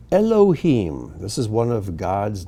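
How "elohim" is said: Elohim as el-oh-HEEM, drawn clearly; Hebrew pronunciation. Shown as a demonstration. Teacher reads one of God's names, Hebrew pronunciation. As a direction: say Elohim syllable by syllable, long final ee. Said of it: "these are the Hebrew pronunciations".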